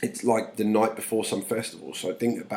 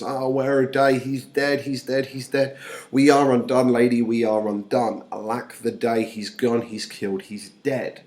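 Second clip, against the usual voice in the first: theatrical wail, strong then tapering; lamenting nurse; 3 semitones higher, 7 dB louder than usual. Acted. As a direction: declaim the nurse's grief, hammed-up. Reads the nurse's lament aloud.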